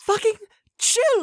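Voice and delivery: ordinary delivery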